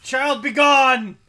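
booming slow exorcist command; mock exorcist command. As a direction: cast out the spirit, drawn out and loud. Banishing ghost, mock exorcist.

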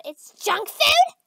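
high, drawn-out disbelief; scandalized shock. Acted. Finding junk food in her friend's bag, scandalized.

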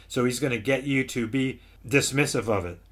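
usual speaking voice

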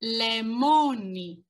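slow, clear "Lemoni", stress on the MO; slow model word. Shown as a demonstration. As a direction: say "Lemoni" slowly for students to repeat. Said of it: "Repeat after me".

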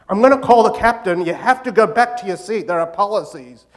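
loud, scolding cabin crew; crabby stewardess. Acted. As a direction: threaten the passenger, loud and officious. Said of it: "They're seventy-year-old crabby, mean".

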